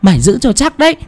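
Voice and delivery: very high-pitched scolding command; snapped order. A narrator's reading. Medium ordering the young man holding the child, high-pitched scolding. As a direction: snap the order in a high voice without much added volume. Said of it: "Cô Sàn nhìn gã trai kia mà quát".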